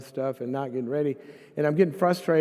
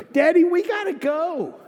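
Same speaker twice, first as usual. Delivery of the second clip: high, loud mock child's cry; little girl; 9 dB louder than usual. Five-year-old daughter to her daddy, urgent child voice. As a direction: pipe up like an impatient child.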